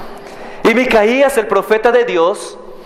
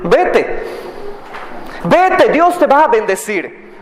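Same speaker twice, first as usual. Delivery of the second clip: sarcastic; 7 semitones higher, slower than usual. Acted. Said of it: "en un tono un poco sarcástico".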